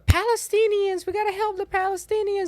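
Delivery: high, whiny mimic of campus protesters; mocking protester voice. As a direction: squeal it like a protester. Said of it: "they're like".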